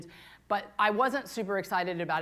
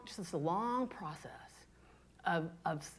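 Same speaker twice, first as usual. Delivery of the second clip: whisper; 4 semitones lower, 10 dB quieter, slower than usual. Real, not acted. Confessional aside.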